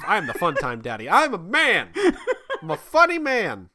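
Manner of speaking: high, loud laughing boast; mock son voice. Son bragging at bedtime, laughing. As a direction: crow it like a giggling kid. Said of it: "listen to my son".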